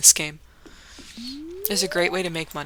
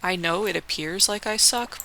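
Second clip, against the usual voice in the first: normal talking voice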